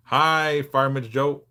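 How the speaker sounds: normal talking voice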